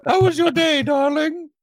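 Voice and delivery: very high sugary sitcom wife voice; sing-song doting spouse greeting; 1950s housewife. Pretend. Mock sitcom homecoming skit.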